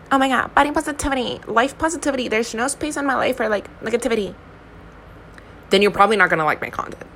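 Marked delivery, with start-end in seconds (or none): 0.1-4.4 higher-pitched mocking advocate voice; mock positivity advocate. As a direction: parody an over-earnest body-positivity advocate in a raised pitch. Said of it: "one of those advocates that's like".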